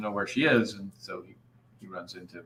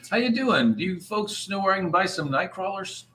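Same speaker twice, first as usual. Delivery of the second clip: cheery fisherman greeting; 6 semitones higher, 4 dB louder than usual. Acted. Fisherman greets shop folks.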